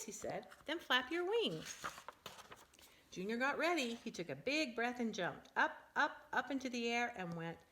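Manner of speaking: lively, animated picture-book reading; reading to children. Acted. Parent reading a silly bird book to her child, animated. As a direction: read it big and bouncy for a small child.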